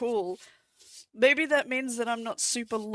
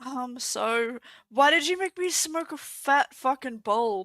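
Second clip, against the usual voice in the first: ordinary delivery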